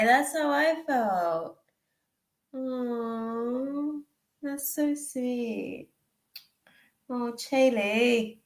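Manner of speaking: slow, high, tender coo; tender, moved. Real, not acted. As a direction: linger softly on each word. Moved by a chat message.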